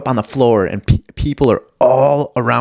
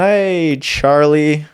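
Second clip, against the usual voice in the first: much higher, bright calling out to a dog; pretending a stranger's dog is his own, much higher; fake owner call; 6 semitones higher, 3 dB louder, much slower than usual. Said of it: "I went".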